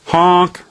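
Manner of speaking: a loud, high honk imitating a horn; a sudden, high car horn honk; car horn imitation. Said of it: "need to honk their horn".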